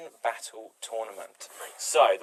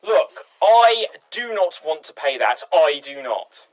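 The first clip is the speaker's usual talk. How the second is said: louder, slow, firmly refusing; indignant loud shopper; 4 semitones higher, 8 dB louder, slower than usual. Pretend. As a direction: put your foot down, loud and slow. Improv shopper refusing to pay.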